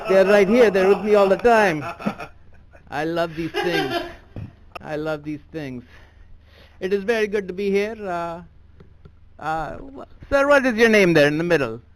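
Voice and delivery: put-on comic thick Indian accent; stand-up bit done in a heavy Indian accent. Comedian on stage doing his act in an Indian accent. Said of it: "I gotta drop the accent".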